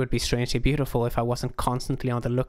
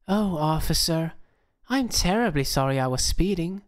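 put-on higher, slower Southern belle accent; Southern flirt; 7 semitones higher than usual. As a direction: drift into a Southern drawl to sweet-talk the cop. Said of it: "my accent drifted a bit south".

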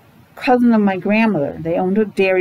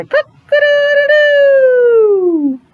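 long, high crowing cock-a-doodle-doo, loud and slow; stretched, piercing rooster call; 5 dB louder, much slower than usual. Shown as a demonstration. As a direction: crow it out like a rooster at dawn. Imitating a rooster crow.

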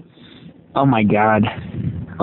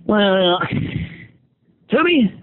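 very high, slow-starting scolding voice; Alvin voice; 10 semitones higher, slower than usual. Acted. Alvin scolding Timmy about ruining the company, very high voice. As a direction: pitch way up and scold like a tiny chipmunk boss. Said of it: "Alvin wants to talk to you".

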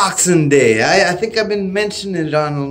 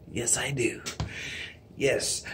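whisper; 7 semitones lower, 18 dB quieter than usual. Real, not acted. Whispered aside about gold.

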